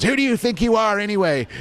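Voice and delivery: high cackling villain voice; Joker taunt. Retold or imitated. Joker challenging Batman mid-fight, high mocking villain voice.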